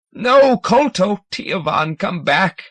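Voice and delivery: high, loud call to come back; desperate command. A narrator's reading. Hegrin calling the young fathers back, desperate. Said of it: "Hegrin's mind command".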